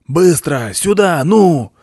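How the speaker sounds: loud, high barked commands; commanding shout. A narrator's reading. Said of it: "заорал уверенный командный голос".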